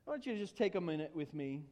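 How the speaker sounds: normal talking voice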